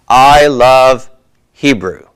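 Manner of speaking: statement tune, falling away on Hebrew; falling intonation. Shown as a demonstration. Teacher showing students a statement, falling intonation on Hebrew. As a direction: state it as fact, pitch dropping on Hebrew. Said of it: "Falling intonation".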